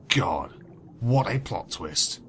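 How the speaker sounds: ordinary delivery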